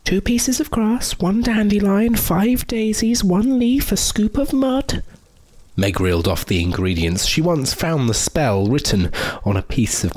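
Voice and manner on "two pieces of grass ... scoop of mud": witch voice. Acted. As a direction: recite like an old witch. Meg recites spell in witch voice.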